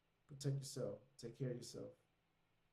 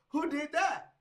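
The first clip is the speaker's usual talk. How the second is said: high, loud incredulous demand; hammed-up indignant question, pitched way up; mock outrage; 13 semitones higher, 7 dB louder, slower than usual. User demanding who took the idea, mock outraged.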